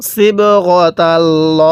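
slow, drawn-out tajweed chant; Quran recitation. Shown as a demonstration. Teacher recites the verse for students, slow tajweed chant. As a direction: intone each syllable slowly like a reciter. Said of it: "এরপরে কিভাবে পড়ব?".

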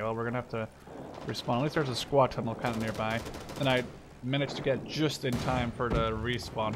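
ordinary delivery

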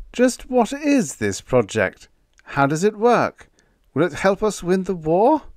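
high, pleading string of questions; small-voiced protest. A narrator's reading. Whitlow pressing the general about the project, small high voice. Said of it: "protested in a small voice".

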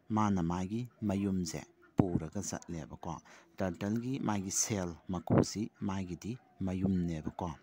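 normal talking voice

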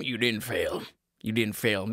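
a dad reassuring his wife; reassuring father. Acted. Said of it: "This is my father".

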